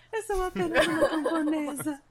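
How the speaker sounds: giggling while echoing the line; laughing. Retold or imitated. Repeating the joke, laughing.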